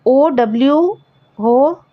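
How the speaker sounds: ordinary talk, nothing marked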